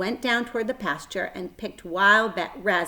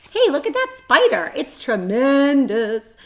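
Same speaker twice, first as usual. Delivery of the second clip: much higher, loud, slow on tremendous; amazed boy; 7 semitones higher, 8 dB louder than usual. A narrator's reading. Avery spots the huge spider, amazed and loud. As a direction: marvel at it loudly.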